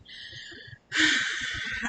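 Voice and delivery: long breathy sigh of relief; weary sigh. Real, not acted. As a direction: sigh out the memory of pain. Recalling the stomach pain.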